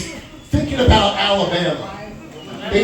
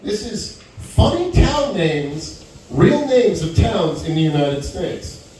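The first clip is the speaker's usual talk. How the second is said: slow, deliberate list intro; slow announcement; slower than usual. Real, not acted. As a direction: announce it slowly for effect. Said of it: "announcing the list slowly".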